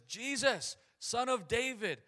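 high, loud plea to be heard; acted shout for mercy; crying out. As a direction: call out over a noisy crowd, stretching the last words. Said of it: "he cries out".

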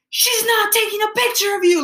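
very high, mock-exasperated outburst; exasperated retold scolding. Retelling his companion correcting him, high exasperated outburst. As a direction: imitate a fed-up companion putting down a dramatic friend. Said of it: "calling him dramatic".

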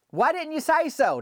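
hollering back as Millie, voice jumps way up; hollered back. Retold or imitated. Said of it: "That's what Millie hollered back".

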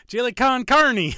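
chili con carne sung out high, loud and slow; high, loud, hammed-up Spanish-style chili con carne; mock Spanish flair. Shown as a demonstration. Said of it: "You gotta say it like this".

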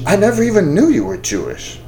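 ordinary talk

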